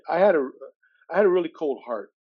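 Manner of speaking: usual speaking voice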